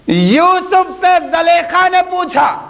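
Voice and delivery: high, declaimed story announcement; full-force preaching. Real, not acted. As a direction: proclaim it in long, sustained high phrases. (بلند، پُرجوش خطیبانہ انداز)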